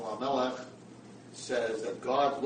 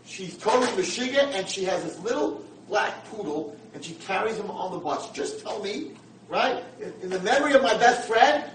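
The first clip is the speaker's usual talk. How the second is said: higher and louder, eager insistence; excited pleading; 9 semitones higher, 10 dB louder than usual. Retold or imitated. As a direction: paint the scene eagerly. Old man pleading excitedly.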